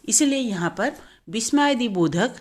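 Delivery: ordinary delivery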